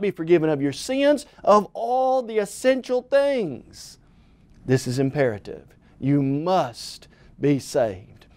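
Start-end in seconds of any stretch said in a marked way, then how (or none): none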